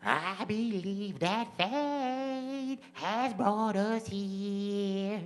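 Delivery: high, very slow raspy-free put-on voice. Shown as a demonstration. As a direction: stretch every word like a singer. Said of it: "this is my impression of [name]".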